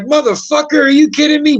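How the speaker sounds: high, loud disbelief; exasperated. Retold or imitated. Fed up with his friend on the phone, high loud outburst. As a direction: blow up in disbelief. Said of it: "I'm like".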